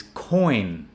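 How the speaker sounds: coin with N clearly sounded; drawn-out coin, complete ending; full final N. Shown as a demonstration. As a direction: punch coin and finish on N. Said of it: "cut the N out".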